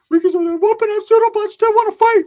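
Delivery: very high and loud, a different squeaky voice; high squeaky mock voice. Retelling the aunt describing Ricky, high squeaky voice. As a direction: squeal it out in a cartoonish pitch.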